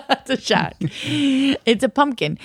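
a hint of Australian, slow and high; mock Australian vowels, drawn out; Australian accent. Retelling the roadside pumpkin seller, a light mock Australian accent. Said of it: "all I know how to say is shark".

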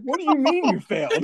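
high, incredulous dad voice; imitating dad. Retelling dad's shock at the failed permit test, high and incredulous. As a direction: raise the voice high in disbelief.

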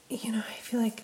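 hushed aside; whispering. Real, not acted. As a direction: drop to a whisper. Aside under her breath.

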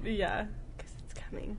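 hushed ominous whisper; horror trailer voice. Pretend. Ominous warning about rising sea.

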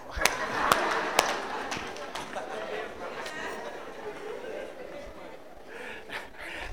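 long high breathy laugh; big laugh. Real, not acted. Preacher laughing at his dinner story, real long laugh.

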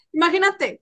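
ordinary talk

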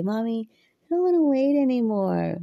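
lightly voiced little girl pleading with mom; imitating daughter. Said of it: "my daughter will say".

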